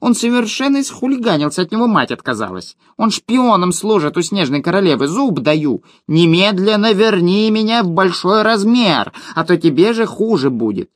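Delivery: high, fast, huffy little gnome; high indignant gnome voice; gnome's voice. Acted. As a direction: puff up like an offended tiny gnome. Gnome Yuhan blustering threat.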